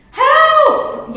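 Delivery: high, very loud yell for help; a trapped captain yelling for help. Acted. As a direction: scream it from the bottom of a pit. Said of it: "yelled Crook".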